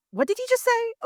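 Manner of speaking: can't believe what you just heard; shocked disbelief. Retold or imitated. Reacting to shocking TV moment.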